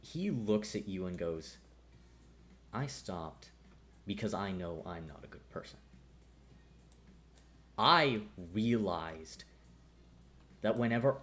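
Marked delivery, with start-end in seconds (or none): 2.7-5.8 soft, heavy admission; quiet confession. Acted. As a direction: confess it softly.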